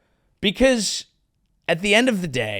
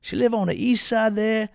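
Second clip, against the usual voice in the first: gullible dude; 6 semitones higher than usual. Retold or imitated. Voicing gullible guy online.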